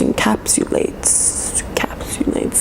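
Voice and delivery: encapsulates whispered slowly, syllable by syllable; whispered practice. Shown as a demonstration. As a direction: mouth "encapsulates" under the breath, slowly. Whispered practice of hard word.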